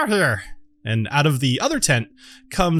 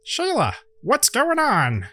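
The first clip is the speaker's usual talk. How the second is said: higher, slow gnome greeting; 10 semitones higher, slower than usual. Acted. GM redoes the gnome greeting Shayla, higher and slower. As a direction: redo it up high and drawn out as the gnome. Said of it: "or sorry, he says".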